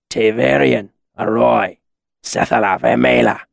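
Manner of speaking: strange deep mercenary voice. A narrator's reading. Possessed mercenary addresses Chronicler in an unknown tongue, deep voice. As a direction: intone it in a deep, otherworldly character voice. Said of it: "he said in a deep voice".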